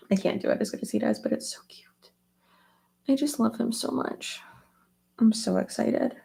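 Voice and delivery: usual speaking voice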